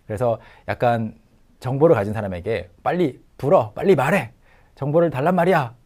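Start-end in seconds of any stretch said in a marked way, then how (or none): 2.8-5.7 pushy interrogator demanding, higher and louder; mock demand for information. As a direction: press them to talk like a harsh interrogator. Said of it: "취조, 신문하던 사람이".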